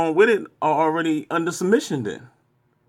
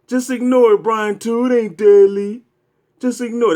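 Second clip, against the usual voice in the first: higher, louder mimicking of the bots; mocking the bots; 6 semitones higher, 6 dB louder than usual. Taunting Brian in the bots' voice, loud and mocking. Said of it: "That's what the dumbass bots be saying".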